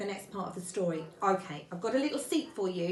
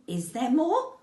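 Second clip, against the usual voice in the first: louder, higher, eager query; quick eager question; 4 semitones higher, 6 dB louder, slower than usual. A narrator's reading. Pooh hoping for more food, quick question. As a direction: jump in with the question. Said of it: "asked Pooh quickly".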